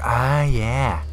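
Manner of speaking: slow, drawn-out creepy relish; creep voice. Shown as a demonstration. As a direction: talk to yourself like a creep enjoying it. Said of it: "just bust that voice out".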